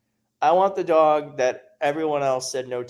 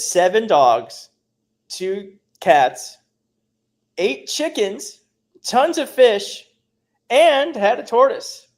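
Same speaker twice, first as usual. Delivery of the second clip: growing amazement; 7 semitones higher, 5 dB louder, much slower than usual. Real, not acted. Reading a viewer's pet list in awe.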